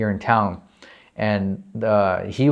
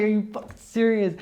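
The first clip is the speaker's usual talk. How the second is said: high-pitched, incredulous mom voice; high, astonished squeak; mom's retold disbelief; 11 semitones higher than usual. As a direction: imitate a stunned mom hearing great news. Voices mom's stunned reply.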